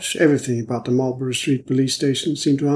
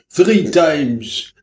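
emphatic, higher and louder reply; lightly voiced detective, emphatic; Hanaud; 4 semitones higher, 5 dB louder than usual. A narrator's reading. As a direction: top the other man, voice raised. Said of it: "replied Hanaud".

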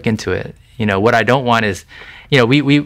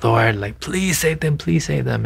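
retold plea to God, pitched up high; high-pitched, urgent pleading without raising the volume; desperate prayer; 6 semitones higher than usual. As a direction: imitate yourself begging hard in prayer. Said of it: "praying so hard to God".

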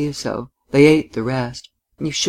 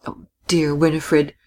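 ordinary delivery, nothing marked

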